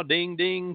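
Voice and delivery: ping it out like a phone notification; imitated phone ding. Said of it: "my phone's going ding".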